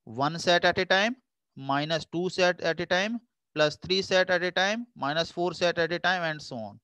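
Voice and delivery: very slow, each phrase lifted at the end; slow recited pattern. Shown as a demonstration. Teacher drilling the set pattern for students, slowly and deliberately. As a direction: spell out the pattern phrase by phrase, unhurried. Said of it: "alternative plus minus लगाते जाना है।".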